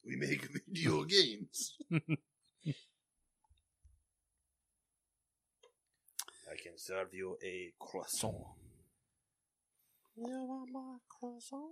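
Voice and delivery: put-on slow, hammy French accent; French chef. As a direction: play a French chef offering croissants.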